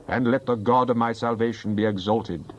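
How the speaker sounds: ordinary delivery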